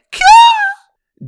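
very high, loud mock girlish shriek; hammed-up panicked squeal; mock scream. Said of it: "she starts screaming and blubbering".

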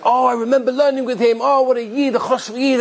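acted burst of happy recognition; delighted reminiscing. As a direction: recall an old friend with delight. Acted rabbi fondly recalls old study partner.

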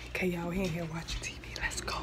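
hushed aside to camera; whispering. Real, not acted. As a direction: whisper so nobody hears. Hidden prank setup to camera.

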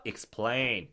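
"explain" slow, stress on the second syllable; model word. Shown as a demonstration. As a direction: stretch "explain" as ik-SPLEIN. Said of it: "한 번 더".

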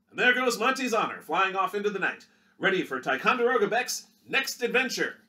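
punchy vintage newsreel read; newsreel announcer. Acted. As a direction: sell it like a 1930s Movietone narrator. Newsreel narrator outro.

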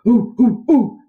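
high, loud monkey hoots; monkey chatter. Acted. Monkeys greet the puppy with loud high hoots. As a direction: hoot like cheeky monkeys. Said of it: "they chattered".

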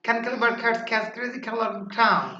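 rapid tongue twister in one run. Shown as a demonstration. Teacher models the last tongue-twister round for students, rapidly. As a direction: rattle the tongue twister off without pausing. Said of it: "তারপর আবার আরো দ্রুত".

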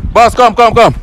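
high, loud hurried summons; repeated urgent call. Real, not acted. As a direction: shout him over urgently. Calling the boyfriend over.

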